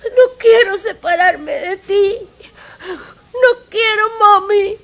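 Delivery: cling to mami through sobs; sobbing child. Acted. Willy sobbing to his adoptive mother not to lose her.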